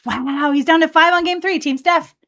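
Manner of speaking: rally the team, bright and loud; a hyped-up cheer from the sidelines; excited cheer. Real, not acted. Cheering on teammate Steph.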